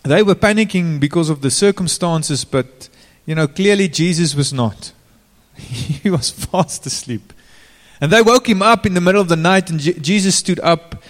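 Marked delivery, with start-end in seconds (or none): none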